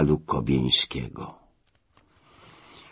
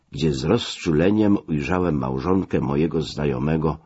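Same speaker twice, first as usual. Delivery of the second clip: ordinary delivery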